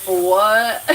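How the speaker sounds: loud, uncomprehending what; mock bafflement. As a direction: call it out like you missed everything. Acting out failing to understand.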